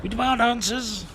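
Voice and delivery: high, put-on demanding voice; hammed-up indignant demand; mock demanding voice. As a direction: play an indignant protester.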